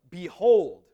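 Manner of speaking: a booming proclamation of behold; commanding behold. Shown as a demonstration. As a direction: announce it to grab attention. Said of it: "it's a word that's like".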